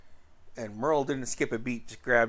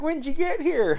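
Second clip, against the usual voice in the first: much higher, eager and delighted; excited question; 4 dB louder than usual. Retold or imitated. Brother asking cousin when he arrived, higher excited question. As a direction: ask like you're thrilled he showed up.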